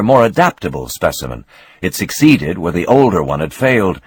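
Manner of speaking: ordinary delivery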